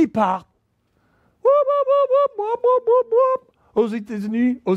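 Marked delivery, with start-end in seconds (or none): 1.4-3.5 high-pitched whoop whoop; high whooping noise. Retold or imitated. Imitates coming and going. (cri aigu whoop whoop)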